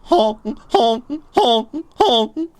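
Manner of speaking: loud, high honks; goose honking. Pretend. Streamer firing a goose rifle, loud goose honks. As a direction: honk like a goose, evenly repeated. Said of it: "That's my goose rifle".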